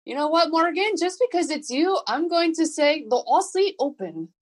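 playful fake Aussie accent; bad Aussie accent. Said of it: "that was so bad of an accent".

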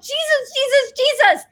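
high urgent cries of the name; calling out Jesus. Retold or imitated. Driver crying out to Jesus as her tire flies, high urgent calls. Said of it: "I went".

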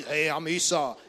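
loud, put-on brother's voice; Esau. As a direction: boom it out as the disguised brother. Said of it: "Can't do nothing about your voice".

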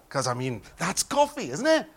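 usual speaking voice

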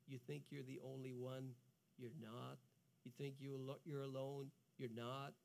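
low, soft and reassuring; preacher comforting burnt-out listeners in a low hush; hushed tender preaching. Acted. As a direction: speak gently, almost a murmur of comfort.

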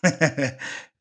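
short, loud laugh; amused laugh. Real, not acted. Host reacts to replayed clip.

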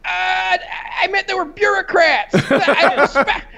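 imitating Rick. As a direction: shrug it off like a careless genius. Rick voiced in retold scene.

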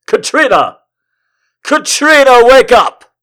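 high, loud cry to wake her; frantic calling out. Acted. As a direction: shout to rouse a sleeping lover. Hero rouses his sleeping love.

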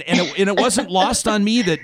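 ordinary talk

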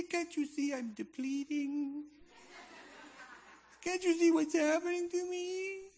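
hammed-up crying plea to the gulls; mock whimpering victim. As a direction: whine like a pecked-at loaf of bread. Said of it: "laying there going".

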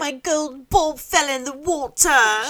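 high, mocking whiny mimicry; hammed-up spoiled princess imitation; mocking echo. Mockingly echoes the princess's line.

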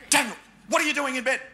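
fast, high, barked mum's demand; angry mum. Retold or imitated. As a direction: snap it out like a furious mother catching her son. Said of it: "she'd be like".

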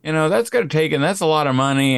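high, whiny protest of a stingy dad; mock whiny dad. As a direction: play the stingy dad objecting. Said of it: "you're like".

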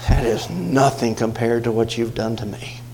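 low, soft, gentle rebuke; sweet, calm voice of God. Retold or imitated. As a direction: answer the rant calmly and softly. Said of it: "in that sweet, calm voice of God".

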